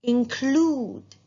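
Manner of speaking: include with a long stretched oo; model word include. Shown as a demonstration. Teacher models include for the class. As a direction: say include slowly and clearly. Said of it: "Another one".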